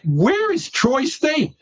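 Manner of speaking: high, drawn-out disbelief; baffled question. Retold or imitated. Lawyer retelling hearing of an unknown college, baffled.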